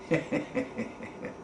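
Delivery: a quick airless chuckle; airy cackle. Acted. The cat laughs off the crabs, airy chuckle.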